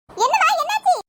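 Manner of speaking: usual speaking voice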